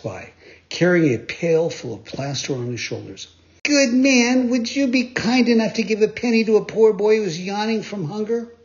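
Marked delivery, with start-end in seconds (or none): 3.6-8.5 high, loud wheedling plea; butter him up, begging; much higher boyish begging voice; pleading puppet boy. A narrator's reading. Pinocchio begging the bricklayer.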